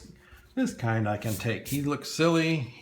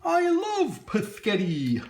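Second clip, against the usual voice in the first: put-on high, slow childish snake voice; silly snake voice; 10 semitones higher, 3 dB louder, slower than usual. Teacher voicing the hoodie snake for kids, high silly voice. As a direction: make the cartoon snake sound silly and sweet. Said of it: "Maybe that's how he talks".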